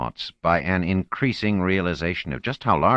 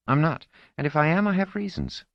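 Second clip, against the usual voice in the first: put-on defensive teenage girl; 12 semitones higher than usual. Marlena snaps back at Orinel.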